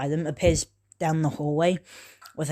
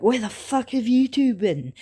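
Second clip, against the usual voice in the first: high, sharp mock-angry demand; annoyed teammates; 7 semitones higher than usual. Teammates scolding latecomers, mock angry. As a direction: snap at the latecomers in a put-on voice. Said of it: "Sasuke and Sakura are like".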